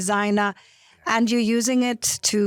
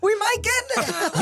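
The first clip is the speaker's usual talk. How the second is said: laughing; 14 semitones higher, faster than usual. Real, not acted. Joking about toppling the regime.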